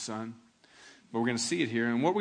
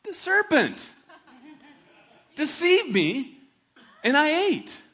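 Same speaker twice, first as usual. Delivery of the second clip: high, loud and slow excuse; blaming Eve; 11 semitones higher, 7 dB louder, slower than usual. Acted. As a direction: Eve pointing to the serpent, drawn out. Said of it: "And the woman said".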